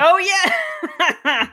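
high-pitched "oh yeah" breaking into laughter; laughing agreement. Real, not acted. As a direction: agree gleefully, pitch shooting up. Amused agreement about bad accent.

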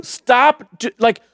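ordinary talk

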